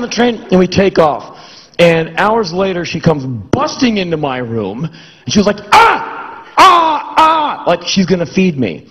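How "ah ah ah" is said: high, loud barking "Ah!"; attendant shout. Retold or imitated. Narrator imitating the angry attendant offering food, high loud shout. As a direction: bark it like a pushy attendant.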